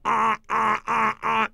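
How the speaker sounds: pinched-nose yelp; silly pattern-interrupt noise; nasal pattern break. Shown as a demonstration. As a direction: honk through a held nose. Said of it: "grab your nose and go".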